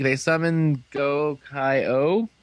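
ordinary talk

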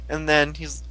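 ordinary talk

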